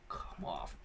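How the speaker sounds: low, soft whisper; whispered words. Real, not acted. Reading a viewer's joke, whispered words. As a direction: drop to a breath on it.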